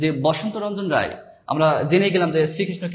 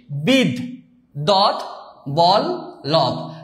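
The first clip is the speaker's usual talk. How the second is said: বিদ দত বল লভ as four slow, separate syllables; standard pronunciation, syllabified; much slower than usual. Shown as a demonstration. Teacher showing students the standard pronunciation, বিদ দত বল লভ syllable by syllable. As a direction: stop after each of বিদ, দত, বল, লভ. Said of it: "প্রমিত যে উচ্চারণ সেটা আমি লিখে দিয়েছি".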